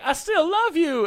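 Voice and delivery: high, slow, tearful-sounding son; son pleading. Retold or imitated. Son telling his addict father he still loves him, high and slow. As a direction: plead like a little boy to his dad. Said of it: "He's like".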